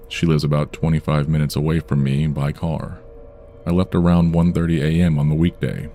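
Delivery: plain reading voice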